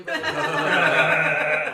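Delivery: slow, laughing drawl. Real, not acted. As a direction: laugh through the words. Teasing about intimacy.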